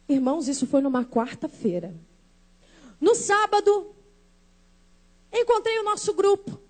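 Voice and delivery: ordinary talk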